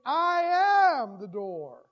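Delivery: very loud and very slow, each word drawn out; thundering proclamation. Acted. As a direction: let it boom across the sanctuary. Climactic pulpit proclamation.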